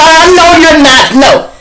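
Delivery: loud, high "I know you're not"; loud, high scolding interruption; judge cutting him off. Retold or imitated. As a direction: shut the copycat down fast.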